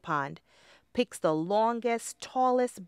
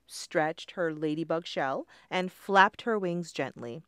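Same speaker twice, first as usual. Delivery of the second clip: ordinary delivery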